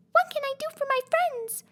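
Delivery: very high, tiny squeaky voice; squeaky mouse queen. A narrator's reading. Mouse queen greets Dorothy in a tiny high squeak. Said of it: "asked in her squeaky little voice".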